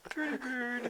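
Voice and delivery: high parrot voice, pretty bird. Pretend. Mocking a parrot voice.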